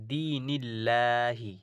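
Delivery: দিনিল্লাহি drawn out slowly, thin light ল; recited light. Shown as a demonstration. A Quran teacher to students, reciting a light example. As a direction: chant দিনিল্লাহি slow and thin. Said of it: "লা পড়ছি".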